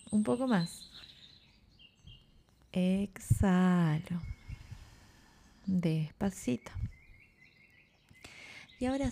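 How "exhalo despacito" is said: long, stretched words with a pause between; slow exhale cue. Shown as a demonstration. Teacher guiding the last breath out, slow drawn-out delivery. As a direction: ease the breath out very slowly.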